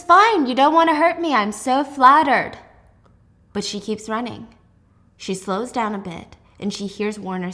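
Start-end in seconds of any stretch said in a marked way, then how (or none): 0.0-2.5 sarcastic. Acted. Warner mocks Juliet's refusal.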